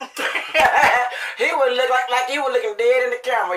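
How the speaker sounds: high, loud and amused; laughing. Real, not acted. Telling viewers about the dog caught on camera, laughing. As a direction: laugh as you get the words out.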